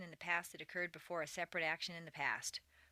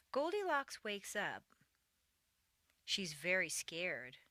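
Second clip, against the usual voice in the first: story narration. A narrator's reading. Teacher reads Goldilocks and the three bears to learners, storytelling. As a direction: read a simple tale, one phrase at a time.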